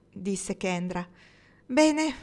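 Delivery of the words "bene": high, loud, short exclamation; raised, decisive burst; exclaiming. A narrator's reading. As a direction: exclaim it to rally everyone. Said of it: "esclamò Marnie".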